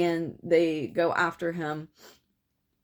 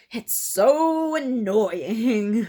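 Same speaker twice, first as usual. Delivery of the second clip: very slow, louder odd voice; deadpan annoyed line; 5 semitones higher, 6 dB louder, much slower than usual. Retold or imitated. Killer whose gun jams, slow annoyed voice. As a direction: drag it out, put-upon and strange. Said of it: "and he says".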